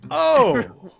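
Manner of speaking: loud, high shouted exclamation; booming "oh" called from afar; villain shouting. Acted. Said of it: "you hear this shouting".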